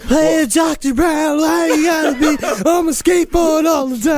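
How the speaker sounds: hammed-up high-pitched movie reenactment; Michael J. Fox. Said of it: "That's the only Michael J. Fox I remember".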